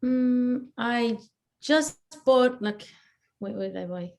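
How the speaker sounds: normal talking voice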